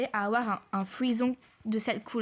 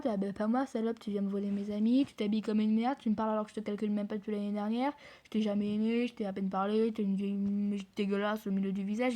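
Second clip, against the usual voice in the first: ordinary talk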